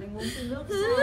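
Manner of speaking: high, loud sobbing question; words pushed out through tears; sobbing loudly, voice cracking; crying. Real, not acted. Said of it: "Sao anh lại khóc thế?".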